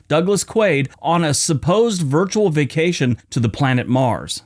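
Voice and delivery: normal talking voice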